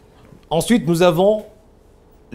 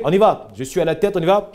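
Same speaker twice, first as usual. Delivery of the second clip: ordinary talk, nothing marked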